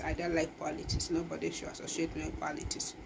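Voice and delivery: ordinary delivery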